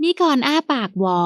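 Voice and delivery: ordinary delivery, nothing marked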